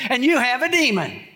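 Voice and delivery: high, blurted second insult; hasty add-on. Retold or imitated. Accusers covering themselves, high blurted insult. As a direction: tack it on quickly to save face.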